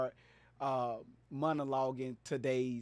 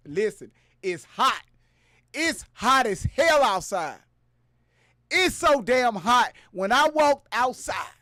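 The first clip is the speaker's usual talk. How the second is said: loud, high, ranting storyteller voice; hyped-up comic rant; 7 semitones higher, 11 dB louder than usual. Acted. Streamer telling viewers a tall tale about the heat, hyped shouting.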